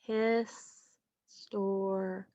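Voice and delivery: "Historic" very slowly in three separate beats, his, stor, ic; syllable by syllable. Shown as a demonstration. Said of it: "How many times did my chin go down?".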